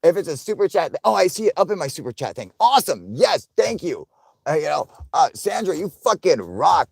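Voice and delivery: high, loud, excited thanks to a donor; hyped thanks. Real, not acted. Streamer thanking Sandra for a super chat, hyped and loud. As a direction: shout the thanks like you just won something.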